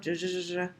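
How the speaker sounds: j, j, j, j as a repeated soft zh; soft g sound. Shown as a demonstration. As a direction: buzz a soft zh sound each time. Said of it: "in order to get my soft G sound".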